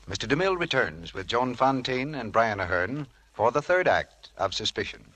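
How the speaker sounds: usual speaking voice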